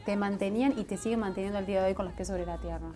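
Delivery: normal talking voice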